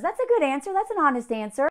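ordinary talk

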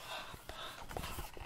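whispered fading hops; whispering. Acted. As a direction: let the hops drift away softly.

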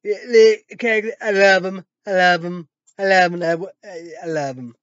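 loud, high gushing as dad; imitating dad gushing. Quoting dad praising Trump, loud mock gushing. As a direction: gush over him like an excited dad. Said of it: "Dad said".

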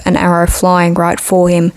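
ordinary delivery

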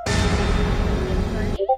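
ordinary talk

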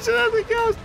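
high laughing yell; laughing shout. Real, not acted. Laughing shout over cows.

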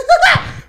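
high, loud gloating cackle; mocking laugh. Real, not acted. Prankster hearing threats to report him, mocking laugh. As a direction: burst out laughing at their outrage.

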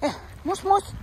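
high coaxing call to a dog; high sing-song call. Real, not acted. Calling the dog Moose.

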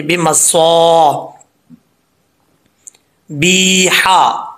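बिमस्वाबिहा stretched out slowly in recitation; recited slowly. Shown as a demonstration. Teacher modelling the word for students, slow recitation. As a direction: draw out बिमस्वाबिहा, the alif held one count. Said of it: "एक अलिफ की मिकदार लंबा करेंगे".

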